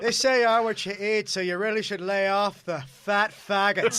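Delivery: loud laughing Irish brogue; Irish accent. Retold or imitated. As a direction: deliver the roast in Irish accent. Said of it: "Imagine that in an Irish accent".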